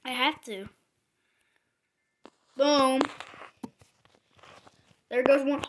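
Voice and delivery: ordinary talk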